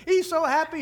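usual speaking voice

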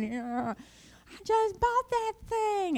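mock mad biker. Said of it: "he's so mad".

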